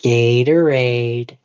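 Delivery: slow, drawn-out Waterboy impression. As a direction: drag the word like Bobby Boucher.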